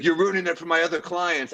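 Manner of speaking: imitating the publicist. Pam scolding him over her other clients, exasperated. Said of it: "she's like".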